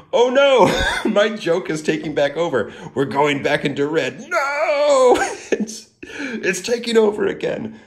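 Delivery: high, loud wail of fake horror; hammed-up cry of dismay, laughing through it; mock panic. Host playing horrified as the running joke takes over, mock panic.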